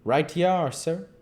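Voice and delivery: a keeper briskly agreeing. A narrator's reading. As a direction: snap it out, brisk and ready. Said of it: "he said briskly".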